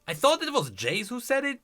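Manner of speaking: ordinary talk, nothing marked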